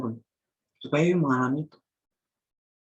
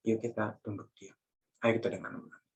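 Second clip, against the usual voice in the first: quiet call to prayer; 2 semitones lower, 8 dB quieter than usual. Real, not acted. Invites congregation to bow silently.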